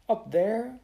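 high, fearful question; quailing. Acted. Mary Joe facing the smoky stairs, quailing. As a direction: shrink from it, voice going up. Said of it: "Mary Joe quailed".

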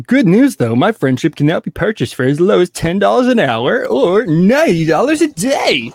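high, swooping hard-sell offer; mock sales pitch. Reading out his reply offering friendship for purchase, mock salesman. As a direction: pitch it like a chirpy advert.

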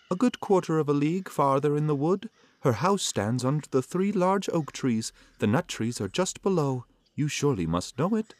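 put-on high, quick little girl; chatty child voice, fast and high. A narrator's reading. Girl gives directions.

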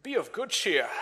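lightly put-on English accent; a touch of mock English accent. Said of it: "he said that in an English accent".

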